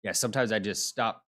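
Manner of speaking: ordinary talk, nothing marked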